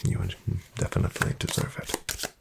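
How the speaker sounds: ordinary talk, nothing marked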